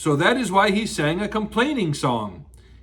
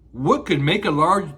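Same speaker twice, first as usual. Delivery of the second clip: usual speaking voice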